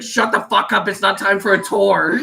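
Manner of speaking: high, loud scolding; mock yell at a drunk friend; retold exasperated shout. Retold snapping at vomiting friend.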